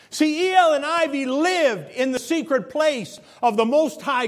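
loud, slow, soaring preaching; fervent preaching. Acted. Preacher lifting the congregation, loud and slow.

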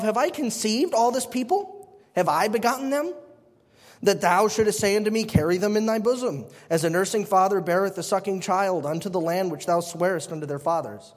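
solemn King James reading; formal Bible reading voice; scripture reading. Acted. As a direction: read the verse with weight.

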